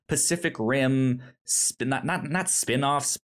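ordinary talk

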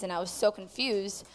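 ordinary talk, nothing marked

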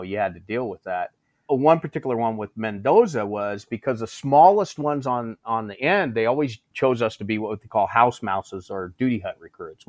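usual speaking voice

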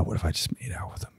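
a quick secret whisper; whispered thought. Retold or imitated. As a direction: confide the creepy thought under your breath. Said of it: "I'm like".

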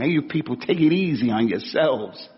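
ordinary talk, nothing marked

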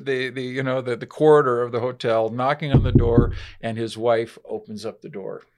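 usual speaking voice

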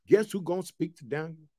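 normal talking voice

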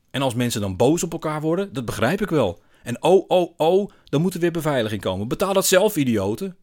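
loud, high mocking indignation; scornful rant. A narrator's reading. Man ranting about paying for security, scornful. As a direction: build up to a scornful outburst.

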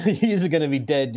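high laughing threat, half joking; laughing while quoting. Retold or imitated. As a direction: laugh through the warning. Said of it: "girlfriend was like".

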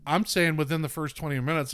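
ordinary talk, nothing marked

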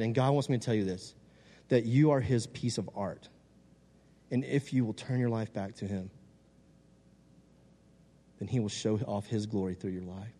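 quiet, earnest reassurance; earnest tenderness. Retold or imitated. Delivering God's message to girl.

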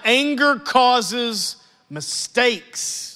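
"Anger causes mistakes" very slow, rising on "Anger"; slow emphatic reading. Shown as a demonstration. Pastor reading the verse aloud, very slowly. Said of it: "reading scripture slowly".

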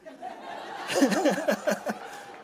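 high, hearty laugh; laughter. Real, not acted. Preacher amused by troop's survival story, laughing. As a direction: crack up at your own story.

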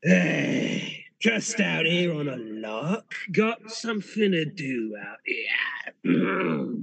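sly hobgoblin drawling, slow; a cocky goblin taking his time; gravelly sly hobgoblin. Acted. GM voices the hobgoblin.

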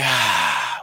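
long exhale sighed out after a deep breath; audible exhale. Shown as a demonstration. Leading a breathing exercise, breathes out with a sigh. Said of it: "Take a deep breath in".

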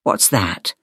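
low-voiced puzzled monster; confused monster. A narrator's reading. Monster Lester asking about competency, low and confused. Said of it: "brow furrowed in confusion".

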